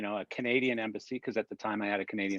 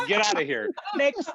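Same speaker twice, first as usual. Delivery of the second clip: very high, loud imitated official; shrill mock officer, louder; shooing customs officer; 5 dB louder than usual. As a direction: shoo him away, then call the next one. Customs officer ordering him out.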